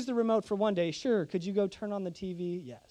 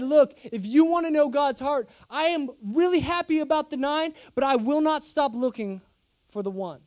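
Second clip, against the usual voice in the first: earnest, rising and louder preached quote; preaching Jesus' words; 4 semitones higher, 4 dB louder than usual. Acted. Pastor voicing Jesus to the church, fervent preaching. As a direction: proclaim it with conviction.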